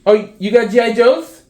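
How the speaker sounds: high, loud, eager call for the toy; excited calling. Real, not acted. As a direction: hurry him over, all excited.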